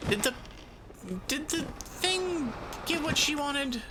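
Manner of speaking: usual speaking voice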